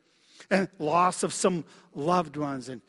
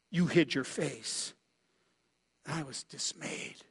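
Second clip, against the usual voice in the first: dismayed lament; 5 semitones lower, 8 dB quieter than usual. Acted. Preacher voicing David's dismay before God, hushed. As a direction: let the words sink into despair. Psalm's turn to despair.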